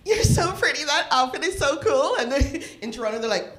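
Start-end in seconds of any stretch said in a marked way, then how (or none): 0.1-2.2 high, gushing imitation of a cheerful teen; bubbly daughter. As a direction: gush like an overexcited girl, higher.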